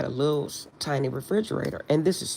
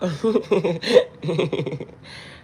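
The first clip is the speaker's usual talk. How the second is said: short real laugh at a viewer's name; 3 semitones higher than usual. Real, not acted. Reading a viewer's name on the channel, a short real laugh. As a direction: laugh off the username.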